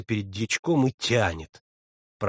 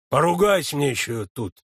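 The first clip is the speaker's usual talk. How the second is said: usual speaking voice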